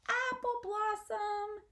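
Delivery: high, slow sung-out call. Acted. Possum calling for lost sister, high sing-song call. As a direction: sing her name out across the hedge. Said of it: "as he calls out".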